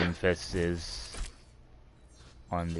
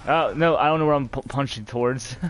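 panicked; 7 semitones higher, 5 dB louder than usual. Real, not acted. Panicking while getting attacked.

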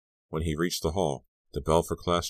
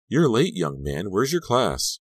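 high, loud reprimand; scolding teacher; 6 semitones higher, 5 dB louder, slower than usual. A narrator's reading. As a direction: call out sternly to a late student. Teacher scolds a late student.

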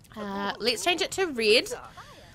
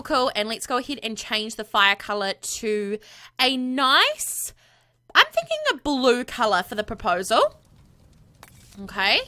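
usual speaking voice

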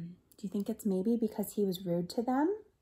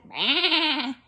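loud imitated sheep baa; big higher-pitched baa; sheep's bleat; 4 semitones higher, 6 dB louder than usual. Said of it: "Sheep said".

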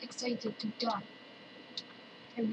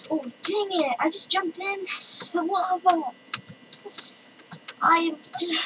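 loud, higher-pitched outburst; exclaiming; 4 semitones higher, 6 dB louder than usual. Real, not acted. Player yelps on jumping into lava, exclaiming. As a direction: cry out at a sudden mishap.

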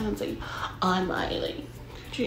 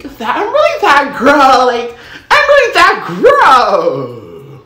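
smug self-praise; 5 semitones higher, 15 dB louder, slower than usual. Retold or imitated. Gloating at herself mid-hookup.

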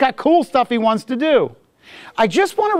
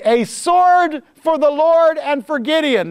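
higher, slow rallying cry; cried out; 7 semitones higher, slower than usual. Retold or imitated. Preacher voicing the soldiers' war cry, high and drawn-out. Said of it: "and cried".

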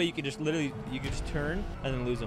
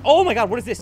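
shocked outburst; 6 semitones higher, 11 dB louder than usual. Real, not acted. Sudden attack in game.

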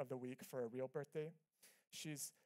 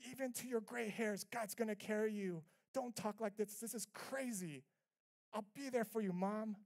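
half angry, half concerned; 5 semitones higher, 3 dB louder than usual. Retold or imitated. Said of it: "I'm just half angry and half concerned".

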